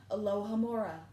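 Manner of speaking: ordinary delivery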